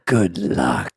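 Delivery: low, drawn-out villain quote; good luck voice. Retold or imitated. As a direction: imitate a movie villain, low and deliberate. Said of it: "That's the good luck voice".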